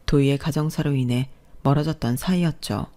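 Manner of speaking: usual speaking voice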